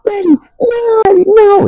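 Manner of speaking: put-on high, piercing screams of a beaten kid begging; desperate screaming. Beaten kid screams at Maddie to stop, high panicked shrieking. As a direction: shriek the name over and over, pleading. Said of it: "the kid's screaming at the top of his lungs".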